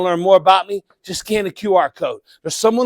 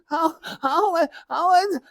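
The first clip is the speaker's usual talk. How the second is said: much higher, whining, on the edge of a mock cry; whiny complainer; 10 semitones higher than usual. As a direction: mimic a self-pitying moaner. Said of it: "How many of you met that person?".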